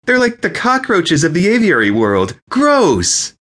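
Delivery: high-pitched, worked-up disgust; disgusted outcry. A narrator's reading. Wedding guest disgusted by pigeons, high outcry.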